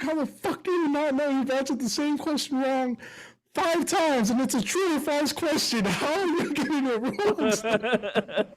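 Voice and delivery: high-pitched, incredulous mock rant; exasperated dad. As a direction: imitate a dad at his wits' end. Dad exploding at wrong answer.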